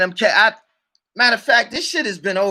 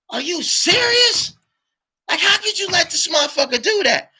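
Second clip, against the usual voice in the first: disbelieving outrage; 10 semitones higher than usual. Retold or imitated. Berating his partner for trusting a crook, high disbelieving cry. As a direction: stretch serious in shock, then rush the rest.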